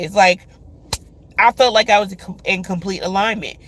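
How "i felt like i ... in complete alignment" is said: normal talking voice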